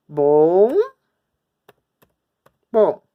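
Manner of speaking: first "Bom" loud and drawn out, long gap, then "bom"; syllable by syllable. Shown as a demonstration. Teacher separating syllables while writing, "Bom ... bom" loudly split. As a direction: hold "Bom", stop, then punch the second "bom".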